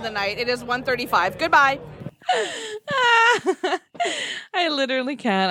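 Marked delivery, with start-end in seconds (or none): 1.8-3.8 breathless laughing fit; helpless laughter. Real, not acted. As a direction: laugh until you can't talk. Laughing at the end.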